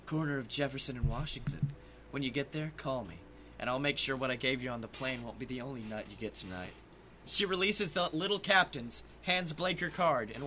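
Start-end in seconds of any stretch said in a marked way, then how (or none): 0.1-6.7 low, soft come-on; quiet, seductive low voice; seductive stewardess. Acted. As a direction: murmur it low, as a private invitation. Stewardess propositions a man.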